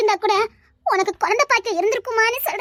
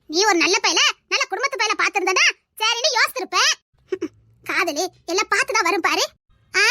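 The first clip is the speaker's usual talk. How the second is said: usual speaking voice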